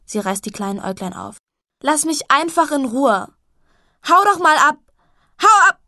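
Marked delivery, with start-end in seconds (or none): 1.8-5.8 building from a loud cry to a high shriek; screaming at sister. Acted. Milia screams at Jessie to leave her alone, building shriek. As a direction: scream until the voice cracks.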